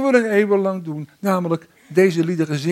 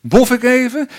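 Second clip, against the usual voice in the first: higher, louder self-congratulating relief; mock relief; 4 semitones higher, 7 dB louder than usual. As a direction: puff out an exaggerated, lucky-me sigh of relief. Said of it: "niet denken van".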